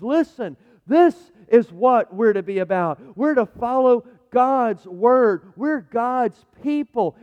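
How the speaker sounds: loud, slow royal call to the people; king's proclamation. Retold or imitated. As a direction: proclaim it to the leaders, slowly and loud. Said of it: "and he says".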